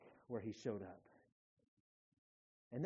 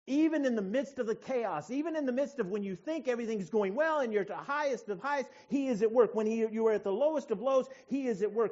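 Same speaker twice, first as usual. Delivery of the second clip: loud, high-pitched sermon delivery; forceful preaching; 9 semitones higher, 12 dB louder than usual. Real, not acted. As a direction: preach it out with conviction.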